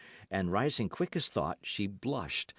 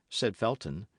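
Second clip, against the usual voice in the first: usual speaking voice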